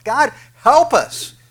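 slow, high, loud plea; voicing a sinner's plea, a slow loud cry; crying out to God. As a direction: stretch it out as a desperate cry for help.

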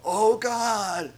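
high, loud, pained mock cry; anguished cry to God. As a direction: cry out as if just hit by tragedy. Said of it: "Something tragic happens in your life and you go".

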